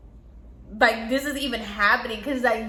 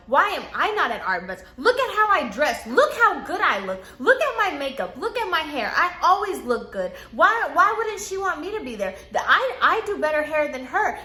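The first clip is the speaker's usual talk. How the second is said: higher, hammed-up wailing rant; imitating ranting client; 5 semitones higher than usual. Client ranting tearfully to the stylists, high and hammed-up. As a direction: whine and wail like a wounded diva. Crying client rants about her daughter.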